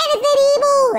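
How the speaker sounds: very high, loud playful cry; mock threatening yell. Player threatening to spank evil, high mock yell.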